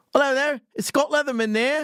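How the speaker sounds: high-pitched, hammed-up Australian caller; Australian accent. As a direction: imitate an Aussie on the phone. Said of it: "was real Australian accent".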